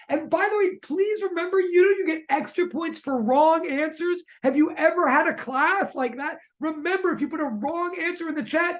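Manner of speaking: high-pitched, eager plea to the class; playfully desperate teacher coaxing a silent room; desperate pleading. Real, not acted. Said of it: "That's how desperate we are".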